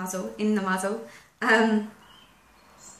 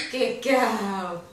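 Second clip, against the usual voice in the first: praising the dog; 3 semitones higher, 7 dB louder than usual. Real, not acted. Trainer rewarding her dog for nose in muzzle, happy praise. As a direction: cheer the dog on.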